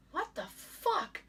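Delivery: slow puzzled what the fuck; confused, drawn out; bewildered. Retold or imitated. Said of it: "And I was like".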